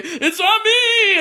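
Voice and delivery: high, slow, loud crying yell; a henchman bursting in, hammed-up panicked wail; mock panicked yell. Henchmen's panicked cry retold.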